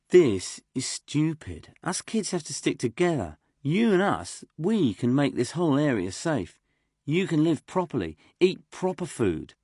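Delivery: Jester pleading. Acted. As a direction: win them over, kid to kid.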